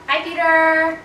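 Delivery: bright high shout-out; excited greeting. Real, not acted. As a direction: call out his name cheerfully. Greeting the date on camera.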